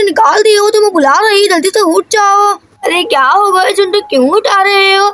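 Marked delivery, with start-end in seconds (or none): none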